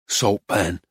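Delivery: low, minimal answer; laconic painter. A narrator's reading. Painter names circles for the dealer, laconic. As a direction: give it low and offhand.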